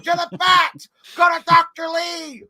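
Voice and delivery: high, loud drawn-out shriek; yelling mom. Retold or imitated. Mom sending the child to the vet, screaming. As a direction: imitate a frantic mother shouting directions. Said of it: "she kept yelling at me".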